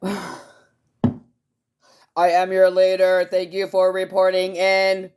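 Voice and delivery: robotic overlord greeting the troops; mock alien leader. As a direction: play a pompous leader from outer space. Alien leader bit to viewers.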